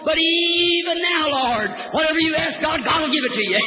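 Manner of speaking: soaring pulpit refrain; fervent faith cry. Acted. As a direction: cry it out like a revival preacher. Preacher shouts the refrain.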